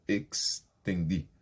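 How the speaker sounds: Extendi with a clear ks sound, slowly; X said clearly. Shown as a demonstration. Teacher showing students a Spanish word, slow and crisp. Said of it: "esse X muito bem pronunciado".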